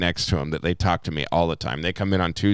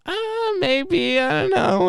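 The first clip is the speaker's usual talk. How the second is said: much higher, coy put-on voice of the ladies; coy women's voice. Bartender imitating the moms turning down a drink, coy and high. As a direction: play the shy, teasing ladies in a high voice. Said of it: "And they're like".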